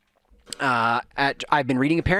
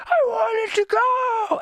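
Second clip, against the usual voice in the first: put-on high, slow, wailing kid voice; wailing child; much slower than usual. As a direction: mimic a crying child's protest. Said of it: "Titus was a wreck".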